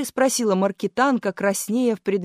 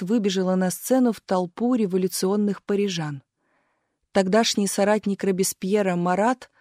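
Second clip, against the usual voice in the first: ordinary delivery, nothing marked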